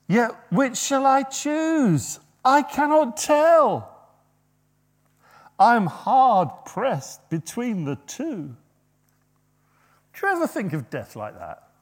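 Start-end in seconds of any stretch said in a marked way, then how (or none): 0.1-8.6 slow, high, loud voicing of Paul torn; torn apostle. Preacher voicing Paul torn between life and death, slow and high. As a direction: draw it out as if torn between two. Paul's dilemma voiced slowly.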